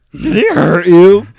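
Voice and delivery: high, slow, loud silly voice; loud high put-on voice. Echo, louder higher.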